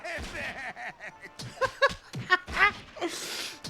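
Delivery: big loud laugh; loud laughing burst. Real, not acted. Laughing at cartoon cry.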